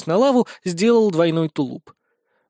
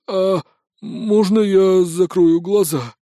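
slow, tearful, quavering question; timid plea; 3 semitones higher, 3 dB louder, much slower than usual. A narrator's reading. Scared wizard asks leader before crossing lava, tearful plea. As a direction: whimper it slowly, like a scared child.

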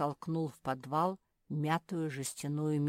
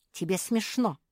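higher, louder cross retort; angered; 4 semitones higher, 6 dB louder than usual. A narrator's reading. Marsia rebuking her giggling friend, angered. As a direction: snap back with mild anger. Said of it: "разозлилась Марсия".

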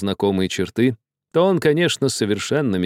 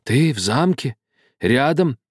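higher, halting, incredulous questions; stunned muttering; 5 semitones higher than usual. A narrator's reading. Old man hears Karlo lives in the castle, stunned. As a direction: echo it piece by piece in disbelief. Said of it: "бормотал он".

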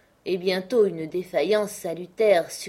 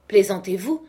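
louder, incredulous uncle; indignant retort; 3 semitones higher, 7 dB louder than usual. A narrator's reading. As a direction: throw it back at him.

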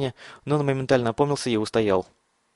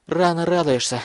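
higher, forced out on breath; breathless; 6 semitones higher than usual. A narrator's reading. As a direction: push it out with effort, gasping. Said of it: "с усилием выдохнул он".

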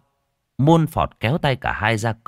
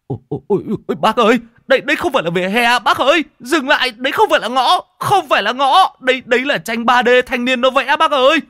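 much higher and louder, fast; panicked screaming; 6 dB louder than usual. Acted. Young driver screams at the old man to brake, panicked. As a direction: scream to stop the car in panic.

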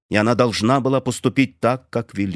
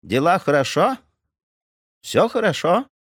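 terse guest; 8 semitones higher than usual. A narrator's reading. Indian guest asks.

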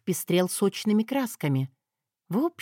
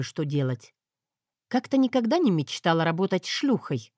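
usual speaking voice

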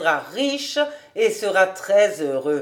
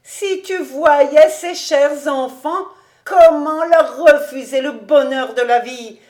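piercing, pathetic plea; 7 semitones higher, 6 dB louder than usual. A narrator's reading. David pleads for the dear children, piercing and pathetic. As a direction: implore with pathos. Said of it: "d'une voix perçante et pathétique".

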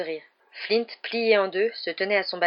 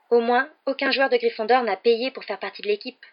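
higher, louder curt retort; 5 semitones higher, 5 dB louder than usual. A narrator's reading. Hermione answering Malfoy's taunts, curt and pointed. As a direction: cut back sharply. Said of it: "dit sèchement Hermione".

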